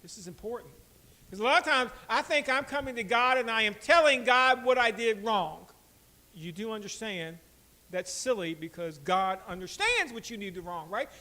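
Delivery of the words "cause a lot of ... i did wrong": loud, raised sermon voice; preaching. Acted. Pastor teaching the church about confession, raised preaching. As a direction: drive the point home.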